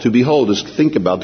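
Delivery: usual speaking voice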